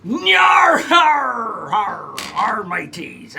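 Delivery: loud gruff pirate growl. Pretend. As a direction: bellow it like a pirate captain. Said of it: "National Talk Like a Pirate Day".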